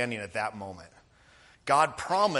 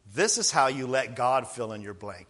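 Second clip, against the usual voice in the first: usual speaking voice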